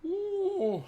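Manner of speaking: high squeal. Real, not acted. Squeal reacting to chat.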